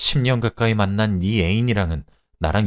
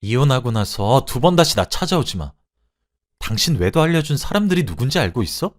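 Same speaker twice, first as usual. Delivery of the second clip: raised, cutting parting shot; snapping angrily; 5 semitones higher, 5 dB louder than usual. Acted. Wife warns cheating husband never to return, angry snapping. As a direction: lash out with finality, louder on key words. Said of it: "라고 쏘아붙이고".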